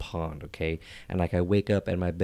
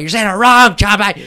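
high, loud mock-furious yell; angry rebuke; 13 semitones higher, 13 dB louder than usual. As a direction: explode at the bungling servant. Master rebukes servant.

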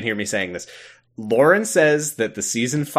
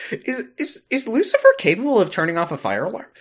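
mock wondering; 7 semitones higher, faster than usual. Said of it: "you're like".

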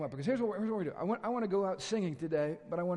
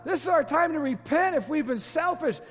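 louder, higher fervent preaching; full-force preaching; 6 semitones higher, 9 dB louder than usual. Real, not acted. Pastor exhorting his congregation, fervent loud preaching. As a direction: preach it out with rising urgency.

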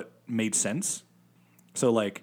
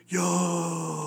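excited yo; 7 semitones higher than usual. Retold or imitated. Excited reaction quoted.

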